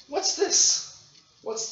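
very high, bright rising question; high-pitched question. Shown as a demonstration. Teacher quizzing the class in a very high voice.

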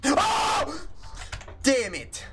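sudden high shout; startled. Real, not acted. Gamer caught off guard, a high startled yelp. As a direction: yelp in shock. Jump scare.

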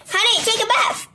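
put-on high-pitched mom calling her child; high, insistent mom shout. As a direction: call out an order as a nagging mother. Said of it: "my mom is shouting at me".

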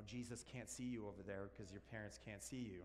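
ordinary talk

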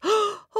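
high sharp gasp; frightened gasp. A narrator's reading. Frightened hare gasping at the noise, high sharp gasp. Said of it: "Hare's heart thumped".

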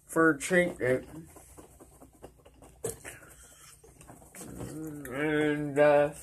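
slightly muffled wet speech; talking with dip in lip. Real, not acted. Seen 5: lower lip looks bulged; spittoon at hand, spits before and after.

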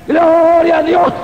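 drawn-out, high, loud praise cry; grito de alabanza. Real, not acted. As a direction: shout praise, stretching the first word. (grito de alabanza agudo y alargado)

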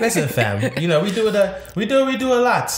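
mock London slang accent; badly faked London accent; fake London accent. Co-host posing as a Londoner, dodgy London accent. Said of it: "Your accent is not good".

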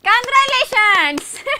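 high, loud, drawn-out cheer; a host hailing the quiz winner; excited congratulations. Real, not acted. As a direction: celebrate the winner at full voice. Host congratulates winning caller.

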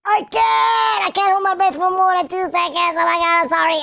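high, hammed-up outburst; mock desperation. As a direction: burst out after failing to hold your breath. Failing to hold breath.

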